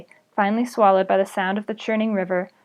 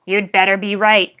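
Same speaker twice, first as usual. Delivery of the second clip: rasped; 5 dB louder than usual. Acted. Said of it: "rasped the goblin".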